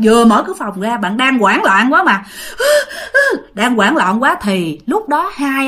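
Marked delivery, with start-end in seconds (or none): 2.3-3.5 high, acted gasp of panic; panicked gasping. Panicked sleeper has just fled the room, high frightened gasp. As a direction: gasp as if frantic with fear.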